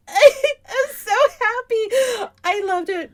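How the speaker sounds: slow, high sobbing through happy tears; imitating herself crying with joy. Fan meeting celebrity, slow high teary sob. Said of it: "And I was like".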